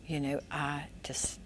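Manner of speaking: whispered, soft confession; hushed apology. Retold or imitated. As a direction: whisper it like a man finally apologizing. Rick whispering his apology.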